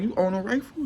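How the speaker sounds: high startled question; a coworker startled by a rifle; surprised question. Retold or imitated. Shocked at rifle.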